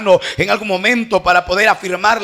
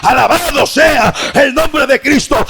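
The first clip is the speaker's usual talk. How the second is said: full-force preaching; 3 semitones higher than usual. Real, not acted. Preacher to congregation on the crucified Christ, building to a shout.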